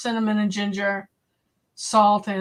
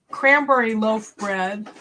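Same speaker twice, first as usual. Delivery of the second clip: usual speaking voice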